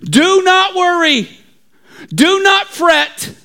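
high, grand mock proclamation; brave Bible student. Bible student rallies the panicking town, brave proclamation. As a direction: proclaim it heroically, drawing out the warnings. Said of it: "one brave s-, Bible student stands up and says".

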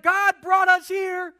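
loud, high, frantic complaining; panicked crowd. Pretend. Trapped Israelites complaining to Moses, loud and panicked. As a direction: whine it in full panic. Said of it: "They're like".

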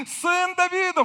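high, loud crying shout for rescue; desperate cry. Acted. Drowning man screaming to Jesus, a high loud desperate cry. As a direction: scream for help like you're going under. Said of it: "Когда кто-то тонет".

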